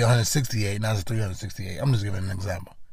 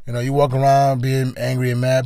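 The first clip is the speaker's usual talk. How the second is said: ordinary talk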